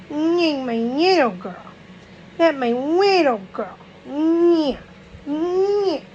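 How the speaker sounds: high, slow cooing; high singsong mommy voice. Real, not acted. Cooing over her little girl.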